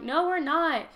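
indignant no; mock indignant denial. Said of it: "they're like".

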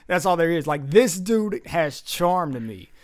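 usual speaking voice

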